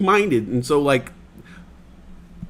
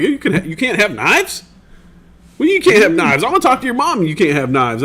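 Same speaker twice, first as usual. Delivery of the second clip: country neighbor; 6 semitones higher, 4 dB louder than usual. Retold or imitated. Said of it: "he was from the country".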